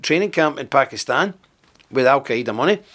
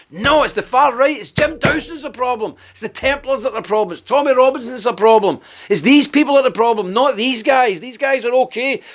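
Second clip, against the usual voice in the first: higher, sneering imitation of the campaigners; mock campaigner voice; 9 semitones higher than usual. Mocking campaigners who blame the far right, higher sneer. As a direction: parody their excuses, pitched up.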